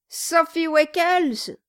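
high, slow, drawn-out cry; shouted name. A narrator's reading. Dwarf echoing his guest's sweetheart's name, shouting. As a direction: sing out the name in a hearty shout. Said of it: "cria le nain".

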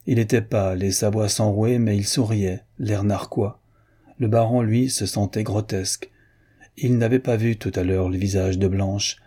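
usual speaking voice

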